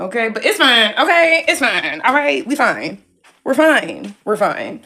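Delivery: loud, high, over-emphatic protest; loudly convince yourself it's all fine; forceful repeated insistence, dropping in pitch at the end; insistent self-reassurance. Real, not acted. Insisting all is fine despite mess.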